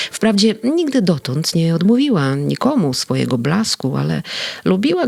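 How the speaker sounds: ordinary delivery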